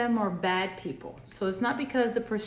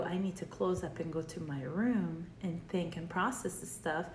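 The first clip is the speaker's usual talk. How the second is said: withdrawn murmur; 3 semitones lower, 6 dB quieter than usual. Retold or imitated. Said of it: "you go".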